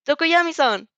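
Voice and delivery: loud high call; shout. A narrator's reading. Calling Tokoyami over the battle noise, loud shout. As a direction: yell his name to get his attention. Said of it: "you shout".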